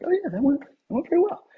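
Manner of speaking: high-pitched, breezy mock self-approval; retold pleased reaction. Actor to self after a take, retold pleased and high.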